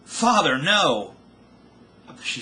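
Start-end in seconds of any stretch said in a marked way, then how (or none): 0.0-1.1 high, loud cry of despair; anguished scream. A narrator's reading. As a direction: scream it as if it can't be true. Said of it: "she screamed".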